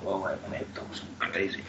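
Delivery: whispered under the breath; whispered aside. Real, not acted. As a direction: mutter it to yourself, unvoiced. Muttered aside mid-sentence.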